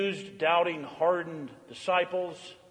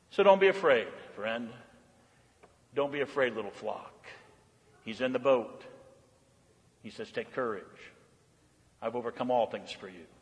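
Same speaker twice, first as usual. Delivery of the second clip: much softer and lower, fading to near hush; tender comfort; 8 semitones lower, 6 dB quieter than usual. Acted. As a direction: comfort a frightened little flock. Closing comfort to the flock.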